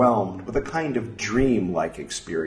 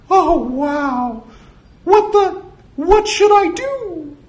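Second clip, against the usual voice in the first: loud, dazed falsetto squeals; very high cartoon-mouse voice; Mickey; 8 dB louder, slower than usual. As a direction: squeak in overwhelmed wonder.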